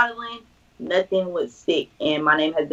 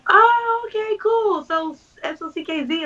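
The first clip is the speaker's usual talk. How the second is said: mock clueless stranger; 13 semitones higher than usual. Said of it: "And they're like".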